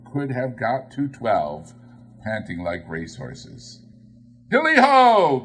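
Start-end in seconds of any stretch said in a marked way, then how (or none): 4.5-5.4 much higher and louder, a ringing whoop; merry cry. A narrator's reading. Fezziwig rousing his apprentices, loud merry whoop. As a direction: whoop it like a jolly old huntsman. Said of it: "cried old Fezziwig".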